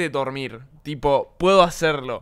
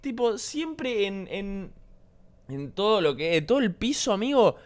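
exasperated rant; 6 semitones higher than usual. Real, not acted. Exasperated rant about the cat.

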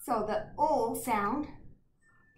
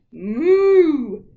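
loud cow moo; 4 semitones higher, 14 dB louder than usual. Acted. Cows mooing in story.

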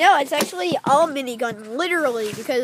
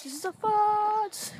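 silly voice; 6 semitones higher, 4 dB quieter, slower than usual. Pretend. Mock voice naming a foot.

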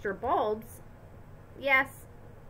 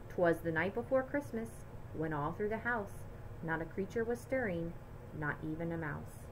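poem recital; 5 semitones lower, 7 dB quieter than usual. A narrator's reading. Reading poem softly.

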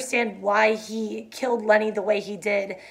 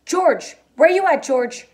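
higher and louder calling; shouted; 7 semitones higher, 6 dB louder, slower than usual. A narrator's reading. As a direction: call out across the brush. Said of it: "Slim's voice shouted".

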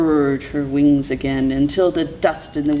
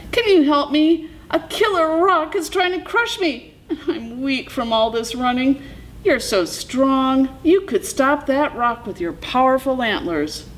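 high, pleading coyote voice; Coyote calling out; 9 semitones higher than usual. A narrator's reading. Coyote begs Mule Deer to stop the rock, calling out. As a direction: call out high, begging for rescue. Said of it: "Coyote called out".

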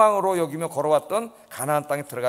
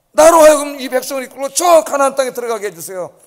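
loud, higher, begging plea to God; pleading prayer; 7 semitones higher, 11 dB louder than usual. Retold or imitated. Loud pleading prayer. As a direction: Moses begging God to let him enter. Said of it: "하나님 앞에 기도했습니다".

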